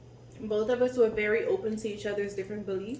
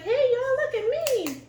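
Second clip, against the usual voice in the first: much higher, louder show-off call; showing-off nephew; 5 dB louder than usual. Retold or imitated. Nephew on the mower calls out to the family, excited show-off call. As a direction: imitate the nephew calling out to everyone to watch. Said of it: "He was like".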